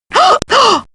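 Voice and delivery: high, theatrical gasp; amazed gasp. Acted. Professor discovering something beautiful, amazed high gasp. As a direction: gasp in wonder.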